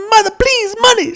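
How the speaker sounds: put-on high, pleading kid voice; child voice. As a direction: beg like a little boy. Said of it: "he says".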